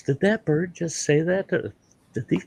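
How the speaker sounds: high, softened disbelief; shocked preacher. Acted. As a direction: ask it high, taken aback.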